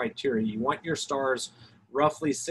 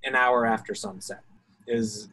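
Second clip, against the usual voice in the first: normal talking voice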